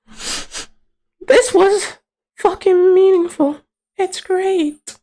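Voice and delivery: very high, slow squeak; mock-moved falsetto. Giving viewers his verdict, mock-moved falsetto.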